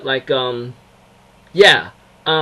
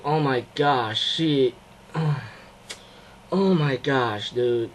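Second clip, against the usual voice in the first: heartbroken sighing; 4 semitones lower, 9 dB quieter, much slower than usual. Retold or imitated. Sighing, heartbroken reaction to the scene.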